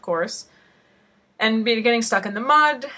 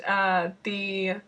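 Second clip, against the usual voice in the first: usual speaking voice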